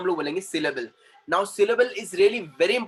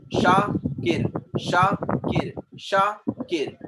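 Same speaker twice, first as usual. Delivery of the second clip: "Shakir" as Sha, pause, kir; split into syllables; 2 semitones higher, much slower than usual. Shown as a demonstration. Teacher drilling the class on syllables, "Shakir" split Sha - kir. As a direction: break "Shakir" into two clear beats. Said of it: "So an is a syllable".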